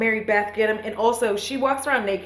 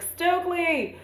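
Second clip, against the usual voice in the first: calling out; 10 semitones higher, slower than usual. Retold or imitated. Elijah calls out to friend.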